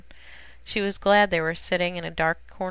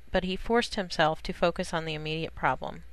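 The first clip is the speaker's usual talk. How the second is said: ordinary delivery, nothing marked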